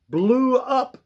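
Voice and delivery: "blew up" punched high and loud; stress on blew up. Shown as a demonstration. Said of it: "I like to emphasize the word blew up".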